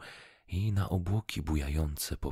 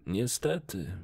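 higher-pitched, mildly sorrowful admission; a touch of sadness; regretful; 4 semitones higher than usual. A narrator's reading. Fisherman admitting he has no fish, regretful. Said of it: "odparł rybak ze smutkiem".

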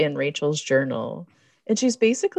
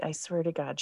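normal talking voice

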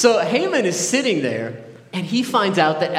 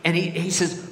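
ordinary talk, nothing marked

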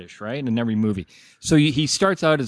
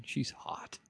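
whispered; 19 dB quieter than usual. Real, not acted. Aside about Tilda Swinton.

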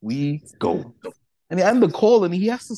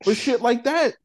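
higher-pitched mock complaint; whiny retold outrage, pitched up; indignant rant; 7 semitones higher than usual. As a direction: replay the outraged complaint to the fan. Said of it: "I'm like".

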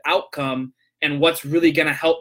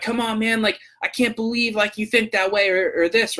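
a higher, mildly exasperated coworker voice; heated disbelief; 6 semitones higher than usual. Retold or imitated. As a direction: imitate a coworker who can't believe you, lightly. Coworker gets heated over politics.

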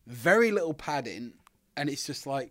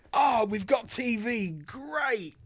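mock excitement; 10 semitones higher, 3 dB louder, slower than usual. Mimicking naive excitement.

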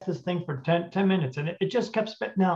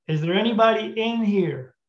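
higher-pitched call into the room; 6 semitones higher than usual. Retold or imitated. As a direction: call out to whoever might be there. Investigator calls into dark room.

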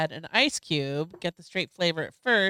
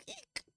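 tiny high-pitched squeak; high squeaky eek; 20 dB quieter than usual. Retold or imitated. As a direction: squeak it out, barely voiced. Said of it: "That one never made me go".

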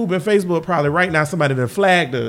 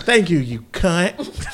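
mock polite insult, slow; 3 dB quieter, much slower than usual. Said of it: "be like".

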